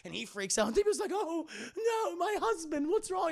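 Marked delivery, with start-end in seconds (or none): 1.1-3.3 high fretful butler voice; fussy C-3PO. Retold or imitated. Streamer voices C-3PO fretting over R2, high fussy voice. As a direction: fret over him in a high prissy voice.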